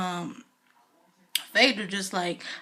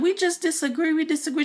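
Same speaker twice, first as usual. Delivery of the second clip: higher, dismissive mock voice; mocking castmates; 8 semitones higher, 3 dB louder than usual. Castmates dismissing crying Portia, mock dismissive. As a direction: brush her off in a lifted voice.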